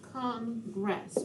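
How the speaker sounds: "Congress" softly, in two separate beats; split into syllables. Shown as a demonstration. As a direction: quietly say "Congress" as Con, pause, gress. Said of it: "we have two syllables".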